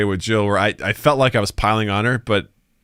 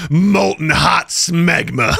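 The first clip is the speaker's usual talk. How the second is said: slow, drawn-out, higher delivery; hammed-up slow proclamation; dramatic read; 5 semitones higher, much slower than usual. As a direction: savor each word dramatically. Reading comment in dramatic voice.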